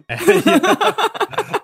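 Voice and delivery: high squeal of laughter; laughing. Real, not acted. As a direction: laugh through it. Laughing at the rhyme.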